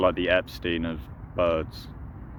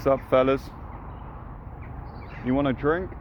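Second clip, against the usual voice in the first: bro greeting; 7 semitones higher than usual. Pretend. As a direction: greet the ducks like an old homie. Offering the ducks a drink.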